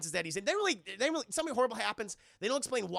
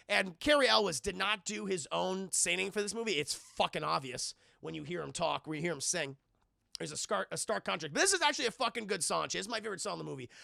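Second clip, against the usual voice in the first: usual speaking voice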